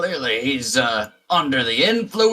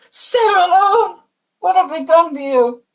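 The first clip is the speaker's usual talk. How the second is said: distraught wife; 6 dB louder than usual. Acted. GM voices wife seeing husband.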